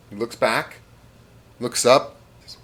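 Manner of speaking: usual speaking voice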